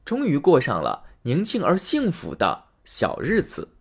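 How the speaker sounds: ordinary delivery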